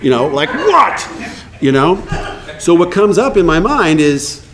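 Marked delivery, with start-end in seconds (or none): 0.5-1.1 irritated snap. Retold or imitated. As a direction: blast the word at the interruption. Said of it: "swing the cannon over to blast her".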